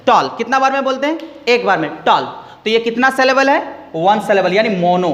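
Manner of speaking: ordinary talk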